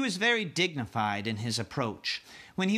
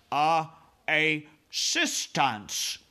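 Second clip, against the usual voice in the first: beaming giant; 3 semitones higher, 4 dB louder, much slower than usual. Acted. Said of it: "the B F G said, beaming".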